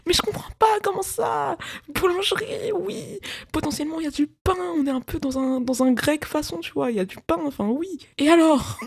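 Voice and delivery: high, baffled self-imitation asking what it means; mock confused self. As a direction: play yourself baffled and a bit silly. Mocking her own confusion.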